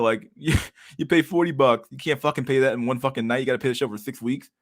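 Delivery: usual speaking voice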